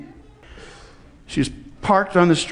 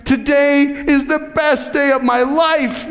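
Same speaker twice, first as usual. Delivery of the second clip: higher and slower, glowing with joy; joyful exclaim; 8 semitones higher, slower than usual. Retold or imitated. Woman tells the pastor by phone of her best day, joyful. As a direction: let the joy lift the whole line.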